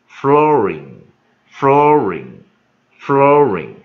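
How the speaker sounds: flooring stretched very slowly and loudly, ring added on; slow emphatic demo. Shown as a demonstration. Teacher drilling flooring for the class, slow and loud. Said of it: "再配一个音".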